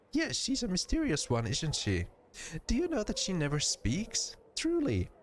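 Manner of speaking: put-on high-pitched girlish game character; high character voice, eager to share; chatty, guileless Nenny.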